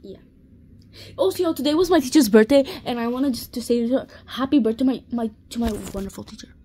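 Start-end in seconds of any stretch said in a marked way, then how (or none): 1.2-6.5 loud, fast, bursting birthday wishes; excited shout-out. Real, not acted. A girl shouting birthday wishes to her teacher, fast and loud.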